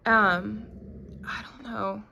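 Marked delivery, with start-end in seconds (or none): 1.3-2.0 a real spooked whisper. Real, not acted. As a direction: barely voice it under your breath. Spooked by barking dog at night.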